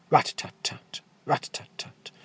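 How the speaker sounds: whispered rat-a-tat drumming; whispered drum roll. Acted.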